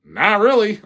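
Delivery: repeated with a laugh, high and loud. Shown as a demonstration. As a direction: say really while laughing.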